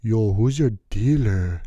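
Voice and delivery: loud, slow, rough stranger calling out; loud street guy. Retold or imitated. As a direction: bark it at a passer-by. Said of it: "all I hear is".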